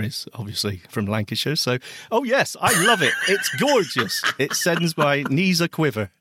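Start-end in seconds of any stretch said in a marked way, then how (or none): 2.1-6.1 higher, laughing declaration of adoration; hammed-up swooning, pitched up and laughing; mock gushing. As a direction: gush playfully through laughter. Mock gushing over partner's accent.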